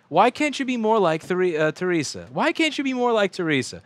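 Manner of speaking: high, loud nagging teacher; mocking teacher voice. Teacher scolding a pupil, high nagging voice. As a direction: whine it like a teacher comparing kids. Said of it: "everybody would say".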